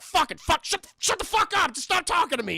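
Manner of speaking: loud, high furious snapping; mad ranting. Retold or imitated. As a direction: bark at them to shut up and leave him be. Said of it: "He's just mad".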